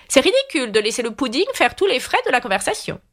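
schooling a guest, queenly and high; bossy Red Queen. A narrator's reading. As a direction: chide in a lightly imperious high voice.

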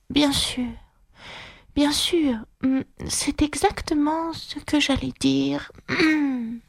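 voix aiguë, plus douce, lente et ensommeillée; Loir endormi qui marmonne. A narrator's reading. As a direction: marmonner à moitié endormi, sans ouvrir les yeux. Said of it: "puis marmotta sans ouvrir les yeux". (le Loir marmonne en dormant, voix aiguë et douce)

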